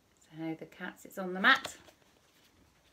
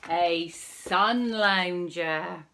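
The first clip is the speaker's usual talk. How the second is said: very slow and louder, stretching "sun"; drawn-out reveal; 7 dB louder, much slower than usual. Acted. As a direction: savour the punchline slowly. Big reveal to children.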